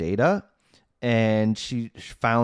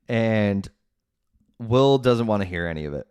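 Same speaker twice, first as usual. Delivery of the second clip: ordinary talk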